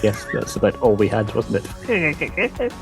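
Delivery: ordinary talk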